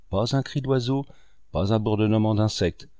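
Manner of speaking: ordinary delivery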